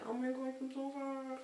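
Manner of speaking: high, soft mock whimper; imitated pitiful apology. Imitating friend apologising after trouble, pitiful. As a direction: squeak out a tiny, sorry plea.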